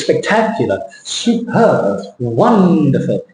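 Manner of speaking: spectacular, superb, wonderful, each stressed syllable stretched; spectacular, superb, wonderful with big peaks on the stresses; exaggerated word stress. Shown as a demonstration. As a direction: lean hard on TAC in spectacular, PERB in superb, WON in wonderful. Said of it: "the stress".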